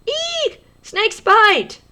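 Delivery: very high, loud squeal "Eek! Snakes bite!"; playful mock fright; excited shriek. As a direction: shriek it like you saw a snake. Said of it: "where I was really excited".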